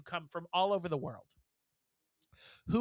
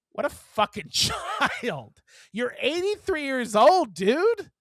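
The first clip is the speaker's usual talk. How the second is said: drawn out, then rising higher and louder; incredulous scolding, raised voice; exasperated outburst; 7 semitones higher, 6 dB louder than usual. Retold or imitated. Store worker scolding a childish old customer, exasperated.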